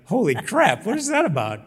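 a what-on-earth outburst; stunned disbelief. Retold or imitated. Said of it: "and I'm like".

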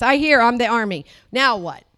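normal talking voice

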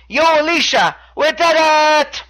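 high, drawn-out triumphant holler; hammed-up victory cry, last words stretched; Noam Dar doing Rocky. Wrestler calls to his love in victory, mock Rocky holler. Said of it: "Interesting Rocky reference".

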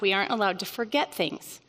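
ordinary talk, nothing marked

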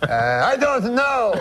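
puzzled cartoon Frenchman, drawn out; uncle's French accent. Stumped uncle answering the cabbie's riddle, French Canadian accent. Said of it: "my uncle goes".

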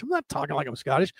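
normal talking voice

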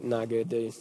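dejected mumble. Retold or imitated. Answering Josh after a bad interview, low and defeated. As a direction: let it drop out of you, beaten. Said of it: "I was like".

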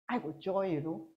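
mock flat "I got joy"; joyless mumble. Shown as a demonstration. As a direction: claim joy with none in the voice. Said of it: "That kind of joy".

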